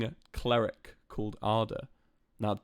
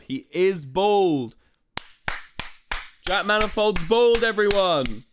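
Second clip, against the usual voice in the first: loud announcement; 8 semitones higher, 7 dB louder, slower than usual. Real, not acted. Teasing announcement to chat.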